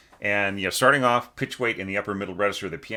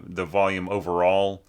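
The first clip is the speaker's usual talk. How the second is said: ordinary talk, nothing marked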